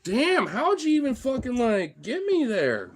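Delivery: high, loud protest; pained disbelief. Real, not acted. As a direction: protest loudly at being hurt. Complaining after being hurt by dogs.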